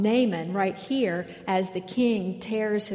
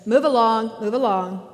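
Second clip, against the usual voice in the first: much louder, dazed order repeated; entranced trooper barking; 2 semitones higher, 7 dB louder than usual. Retold or imitated. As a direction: wave them through like a hypnotized guard. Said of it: "entranced by the Force".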